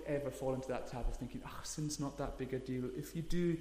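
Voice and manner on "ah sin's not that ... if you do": ordinary talk, nothing marked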